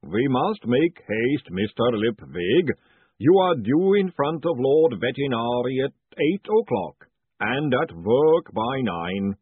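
slow, loud booming giant; booming golem voice. Acted. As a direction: boom it out steadily. Golem speaks.

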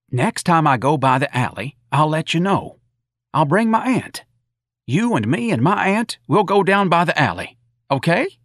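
high-pitched, folksy and keen; eager, friendly patrolman. Acted. Patrolman coaxing Mrs. Riley to bowl, eager.

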